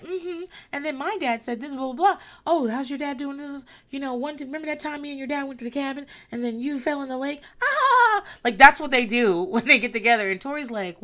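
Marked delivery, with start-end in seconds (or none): 0.0-7.4 higher, lightly voiced gossipy chatter; breezy catching-up voice; chit-chatting exes. Exes chit-chatting about old times, higher and breezy. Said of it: "They're just like". 7.6-8.2 exasperated shriek. Real, not acted. Exasperated cry after quote.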